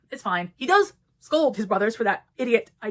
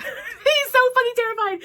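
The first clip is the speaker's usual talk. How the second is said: laughing through the words, pitched high; cracking up at her own line; 6 dB louder than usual. Real, not acted. Reviewer laughing as she tells Nick's panic, laughing.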